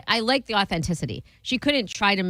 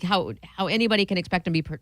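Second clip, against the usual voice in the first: ordinary talk, nothing marked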